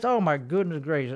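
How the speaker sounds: very soft, low mock gasp; mock prissy gasp. Still mocking the fan, a very soft low prissy gasp. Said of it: "don't say it in that fucking voice".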